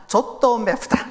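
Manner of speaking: put-on slow Korean with a Japanese accent. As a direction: sound like a Japanese learner. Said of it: "이렇게 말해요".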